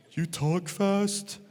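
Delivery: very slow, deadpan student drawl; mock slow student. Student mocking the sub's fast talk, very slow. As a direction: drag every word out.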